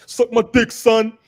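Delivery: loud and high, New York heckler; New York accent. Retold or imitated. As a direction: shout it like a brash New Yorker.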